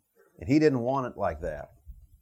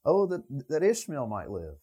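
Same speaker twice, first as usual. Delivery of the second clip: raised-pitch mock pleading; 7 semitones higher than usual. A believer begging God to keep his Ishmael, higher pleading. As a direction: beg like a worried believer. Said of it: "done the same thing".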